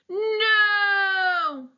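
high, loud wailing no. Acted. Gale wailing that the plan failed, high and wailing. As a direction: wail it out like a heartbroken snail. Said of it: "wails Gale".

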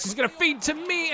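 ordinary delivery

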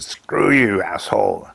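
mock-angry outburst, imitated; retold insult. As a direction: fling it out as a quoted jab, falling away on the last word. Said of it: "looked over at Lindsay and she said".

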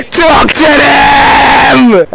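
high, very slow, drawn-out yell; triumphant yell. Real, not acted. Gamer celebrating his scorer, triumphant yell. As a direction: stretch it out in triumph.

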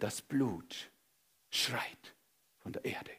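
hushed, grave whisper; solemn whisper. Acted. God telling Cain the blood cries, whispered. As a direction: breathe it out gravely.

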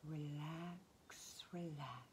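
hushed, drawn-out near-whisper; soft soothing cue. Acted. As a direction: breathe the word out softly to soothe. Soothing cue within guided relaxation.

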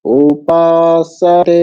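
slow, drawn-out উপাসতে with tongue lightly on teeth for স; dental s. Shown as a demonstration. Teacher modelling dental স, উপাসতে very slow and sustained. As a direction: hold the long syllables of উপাসতে, dental স. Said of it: "দন্ত সাকার উচ্চারণ করতে হবে".

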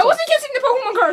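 very high, fast, loud; indignant yelled denial. Pretend. Luigi denying he kissed the card, indignant squeal. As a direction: squeal the protest in a rush.

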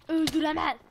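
shouting it out loud; loud outburst. Real, not acted. Oyunda heyecanlı bağırış. (yüksek sesle bağırış)

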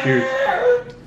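very high, slow whiny cheers; squeaky mock cheers. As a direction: stretch it out in a squeak. Mock toast, high crying voice.

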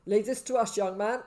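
loud, firm order; gruff paramedic. A narrator's reading. Burly paramedic sends the boy off, gruff. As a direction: take charge gruffly and loudly. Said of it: "who was gruff".

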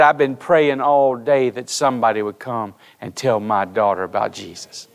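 low, soft, choked-up retelling of a weeping father; a hint of a broken-down dad in tears. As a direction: let the father's words sink low and break softly as he weeps. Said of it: "started weeping".

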